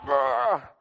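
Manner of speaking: one last high roar; imitated crazed roar. Preacher showing how the man went, mock roar. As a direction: mimic a crazed man's roar. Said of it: "like that".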